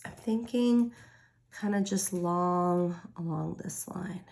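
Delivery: breath held, slow and hushed; holding breath. Real, not acted. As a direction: speak while holding your breath. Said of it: "I'm holding my br".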